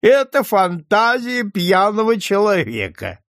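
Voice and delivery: high, hilarious exclamation; tipsy scoffing, pitch way up; drunken amusement. A narrator's reading. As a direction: crow it out, greatly amused. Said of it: "снова засмеялся Рогачёв".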